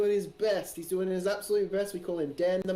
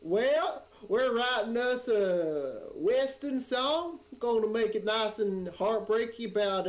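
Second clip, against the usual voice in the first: slow, higher Southern drawl; Southern US accent; 4 semitones higher, slower than usual. Acted. Streamer hams up a bad actor's Southern country-singer drawl. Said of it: "American sketch with bad actors".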